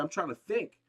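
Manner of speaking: ordinary talk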